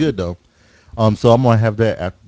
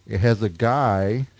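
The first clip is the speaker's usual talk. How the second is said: normal talking voice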